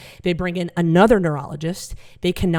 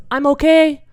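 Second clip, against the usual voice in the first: dazed dad; 7 semitones higher, 6 dB louder than usual. Retold or imitated. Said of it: "he goes".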